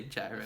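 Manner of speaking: gyro with a J, as JAI-ro; wrong pronunciation. Shown as a demonstration. Vlogger pleading for the love of God, gyro said wrongly as JAI-ro. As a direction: mispronounce gyro to rhyme with Cairo, JAI-ro. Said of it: "don't say".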